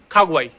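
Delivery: खागुआई as "khaa-gwaa-ee". Shown as a demonstration. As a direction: sound KH and GU in खागुआई. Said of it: "इसको क्या बोले".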